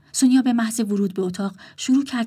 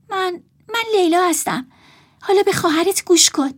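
put-on higher, louder young woman, stammering then firm; Leila's voice; 10 semitones higher, 5 dB louder than usual. A narrator's reading. Leila answering the rude brother, higher and firmer. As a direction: stand up to him.

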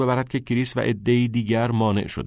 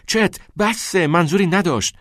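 high, raised cry to stop a fight; pleading shout; 9 semitones higher, 4 dB louder than usual. A narrator's reading. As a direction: cry out urgently, voice pitched high. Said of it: "کریس فریاد کشید". (فریاد ملتمسانه با صدای زیر)

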